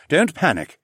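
head off a panic; warning. A narrator's reading. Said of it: "Mateo warned".

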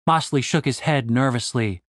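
ordinary delivery

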